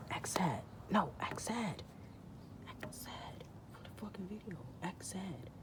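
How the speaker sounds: very soft urgent coaching; under-the-breath order to the dog; hushed aside. Real, not acted. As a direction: hiss it quietly, off to the side.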